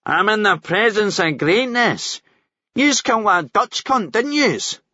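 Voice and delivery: high, slow, mocking ned voice; cocky Glasgow ned. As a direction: taunt them like a cocky ned. Cocky prisoner taunts them.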